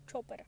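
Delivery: Chopper said as "CHOH-per"; playful name variant. Shown as a demonstration. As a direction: say Chopper with a long "oh" in the first syllable. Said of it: "I call him many different".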